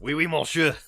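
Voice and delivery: mocking cliché French accent. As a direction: camp it up like a film Frenchman. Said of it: "the French accent is so disgustingly stereotyped".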